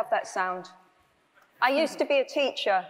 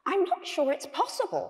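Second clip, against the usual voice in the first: high-pitched, anxious little character; worried voice; 6 semitones higher than usual. A narrator's reading. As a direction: fret in a small high voice. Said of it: "said a worried voice".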